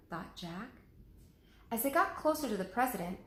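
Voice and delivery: ordinary delivery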